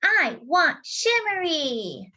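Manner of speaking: put-on high, slow pleading toddler; wanting little boy. Toddler brother asks for the skirt, slow and high. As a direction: stretch each word out, wanting it. Casey wants it.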